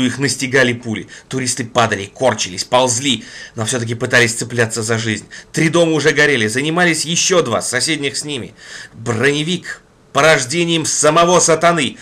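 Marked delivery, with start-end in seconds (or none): none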